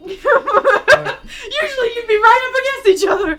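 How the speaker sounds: high, slow, laughing through words. Real, not acted. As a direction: crack up while narrating. GM laughing at scene.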